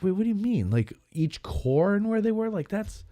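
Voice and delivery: high-pitched disbelief; high, baffled and incredulous. Retold or imitated. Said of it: "and I was like".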